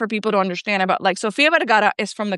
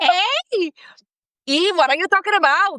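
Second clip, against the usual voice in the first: tipsy Colombian accent; 7 semitones higher than usual. Shown as a demonstration. Guest showing cohost her two-drink accent, thick Colombian accent. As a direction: let a big Colombian accent loose after two drinks. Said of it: "my accent just goes like".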